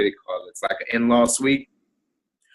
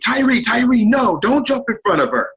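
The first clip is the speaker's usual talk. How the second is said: frantic shout; 10 semitones higher than usual. Retold or imitated. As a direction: yell his name to hold him back. Wife and sister-in-law holding him back.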